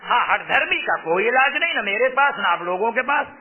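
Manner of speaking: ordinary talk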